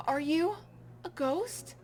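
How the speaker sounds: ordinary talk, nothing marked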